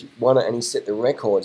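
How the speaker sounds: normal talking voice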